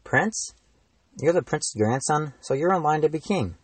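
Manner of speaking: high astonished voice; surprised. A narrator's reading. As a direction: gasp it out in disbelief. Shocked at royal name.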